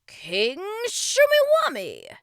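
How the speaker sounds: slow, booming, high proclamation; emphatic repeat. A narrator's reading. King repeats name for puzzled boy, slow and emphatic. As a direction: stretch the name out loudly. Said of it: "the king repeated".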